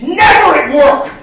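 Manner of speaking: high, loud, adamant "never"; emphatic shout. Acted. Comic on men crying at work, emphatic mock shout. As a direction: hammer "never" as an absolute rule.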